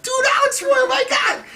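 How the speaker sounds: very high, loud cheer; excited shout. Retold or imitated. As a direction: holler like thrilled cops. Cops cheering him outside.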